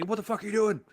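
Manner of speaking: furious drill sergeant bawling out a recruit; angry drill instructor. Retold or imitated. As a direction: yell at the recruit on the ground. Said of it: "knife handed me".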